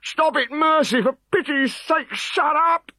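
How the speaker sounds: very high hysterical pleading; shrieking. Acted. Decurion begging the bard, a hysterical shriek. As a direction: shriek for mercy in a frenzy.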